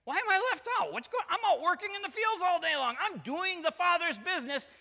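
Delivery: high, loud indignant rant; hammed-up older-brother outrage; irritated son. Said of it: "The son's so irritated".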